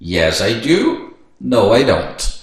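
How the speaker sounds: slow, carefully spaced dialogue; slow teaching demo. Shown as a demonstration. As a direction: model question and answers slowly. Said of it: "a gente tem uma pergunta ali". (diálogo em inglês bem devagar)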